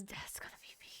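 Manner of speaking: hushed whisper, fast; whispered aside. Real, not acted. Streamer whispering to chat while watching. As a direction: mutter it under breath quickly. Reacting to video, muttering.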